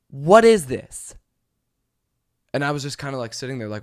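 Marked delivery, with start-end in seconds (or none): 0.1-1.1 drawn-out, louder, contemptuous; snooty passenger dragging it out; slow disdainful repeat. Retold or imitated. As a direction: stretch every word in disgust. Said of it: "and she says".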